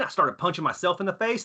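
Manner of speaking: ordinary talk, nothing marked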